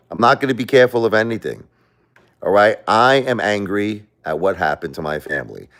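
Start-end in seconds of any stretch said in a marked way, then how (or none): 0.1-1.6 quick, firm repeat of "I'm not gonna be careful of anything"; fast firm vow. Real, not acted. To viewers, repeating his defiant vow quickly. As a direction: rattle off the vow with resolve.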